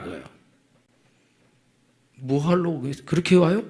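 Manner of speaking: ordinary delivery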